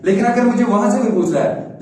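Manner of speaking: ordinary talk, nothing marked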